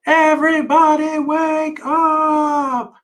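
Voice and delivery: long, high wake-up call held on every syllable; high drawn-out shout. Acted. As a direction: shout it slowly to wake the whole house. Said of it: "and exclaimed".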